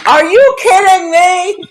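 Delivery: high, loud disbelieving cry; a loud, high are-you-kidding-me; incredulous. Retold or imitated. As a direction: blurt it out in shock. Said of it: "I'm like".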